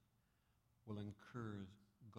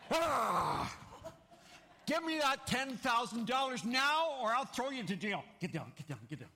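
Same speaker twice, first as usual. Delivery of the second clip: furious shout; 13 semitones higher, 11 dB louder than usual. Acted. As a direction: roar the demand with violence. Grabs neighbor by throat, demanding.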